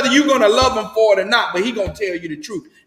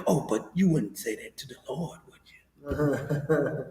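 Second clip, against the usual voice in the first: much lower, much softer near-whisper; late night DJ voice; 6 semitones lower, 11 dB quieter than usual. Retold or imitated. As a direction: breathe it out like a seducer. Pastor seducing in a hush.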